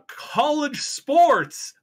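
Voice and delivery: high, slow, drawn-out hype reveal; hyped announcer. Acted. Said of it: "That's right, college sports".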